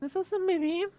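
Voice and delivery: high, soft falsetto woman; man doing a woman's voice. Pretend. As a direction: ask it like a flirty woman. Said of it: "Kadın sesi yapacaktım".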